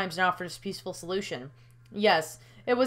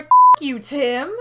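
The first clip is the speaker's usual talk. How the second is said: a loud burst on the curse, then the name; angry shout; 4 semitones higher, 12 dB louder than usual. Acted. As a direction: spit it at him. Cursing the alien again.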